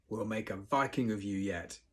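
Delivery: plain reading voice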